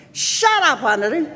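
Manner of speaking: high-pitched snap, falling on the name; imitated mother's yell. Mother silencing singing father, imitated yell. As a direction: cut him off from across the house. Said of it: "you hear this voice going".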